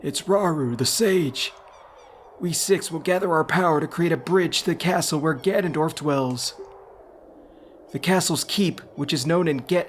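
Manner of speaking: put-on high, far-off old sage voice; old man voice. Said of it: "it's like an old man voice".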